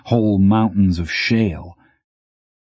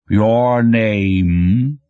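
slow, heavy voice of a mountain; rumbling mountain voice. Acted. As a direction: speak slowly, like a mountain talking. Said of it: "its voice about what you'd expect from a mountain".

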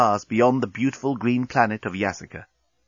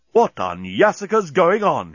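slow, higher-pitched indignant exclamation; exclaiming; 6 semitones higher, 3 dB louder than usual. A narrator's reading. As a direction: exclaim it slowly, voice raised in pitch. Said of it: "he exclaimed".

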